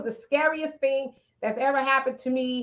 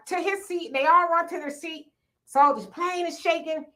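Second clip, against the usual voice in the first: ordinary delivery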